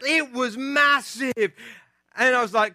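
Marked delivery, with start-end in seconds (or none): 0.0-1.5 higher, slow British kid impression; drawn-out British accent, mock awe; thick British accent. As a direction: imitate a small British boy, savouring each word. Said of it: "thick British accent".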